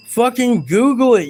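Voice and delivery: fed-up streamer yelling at a chatter; angry shout. Real, not acted. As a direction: blast it at the idiot in chat. Angry reply to chat troll.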